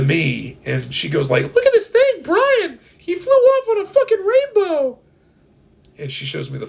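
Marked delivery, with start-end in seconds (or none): 1.5-5.0 very high, loud panicked shrieking; frantic villager. Pretend. Panicked villager reporting to Brian.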